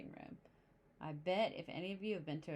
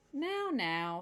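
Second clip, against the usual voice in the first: soothing dad; 5 semitones higher, 5 dB louder than usual. Acted. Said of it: "said Papa Bear as he kissed".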